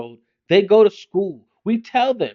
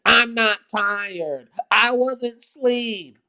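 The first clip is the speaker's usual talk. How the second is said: put-on grumpy child; 2 semitones higher, 3 dB quieter, slower than usual. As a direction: sulk like a cranky four-year-old. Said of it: "Now you wanna be mad".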